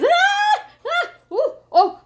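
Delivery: high, loud yelps of fright with a laugh at the end; hammed-up panicked screams ending in a laugh; startled shrieks. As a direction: shriek at the sight of a spider. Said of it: "And the first thing is".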